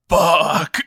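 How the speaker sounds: retold frustration. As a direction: act out a curse at your own slip. Acts out spotting the typo.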